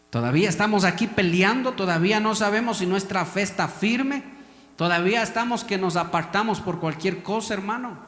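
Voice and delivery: rising preacher's cry; fervent sermon build; preaching. Acted. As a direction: press the congregation hard.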